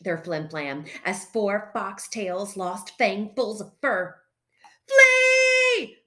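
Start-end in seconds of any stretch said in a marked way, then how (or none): 4.9-6.0 high, loud, drawn-out shout of alarm; barking. A narrator's reading. Fred to his frightened family, barking. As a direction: shout the warning at full force. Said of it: "barked Fred to his frightened family".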